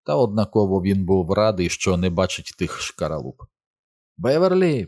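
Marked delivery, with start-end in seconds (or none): 4.2-4.9 high, long call; raised-pitch searching call. A narrator's reading. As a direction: call her name across a distance. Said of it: "погукав Бен".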